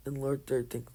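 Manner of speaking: plain reading voice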